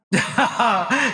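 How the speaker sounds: short high villain laugh; scornful chuckle. Acted. Scientist sizing up an unwanted specimen, scornful chuckle.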